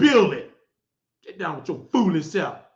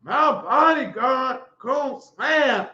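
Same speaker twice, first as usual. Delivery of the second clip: slow, higher mock of his boast; mocking his threat; 4 semitones higher, slower than usual. Mocking a rival's bodyguard threat, slow mock voice. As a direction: sneer his words back slowly. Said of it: "How you gonna say".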